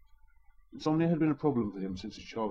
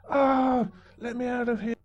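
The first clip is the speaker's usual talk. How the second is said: desperate inner thought; 14 semitones higher, 6 dB louder, slower than usual. Acted. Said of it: "but was really thinking".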